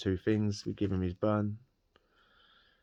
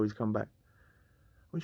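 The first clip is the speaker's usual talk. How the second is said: usual speaking voice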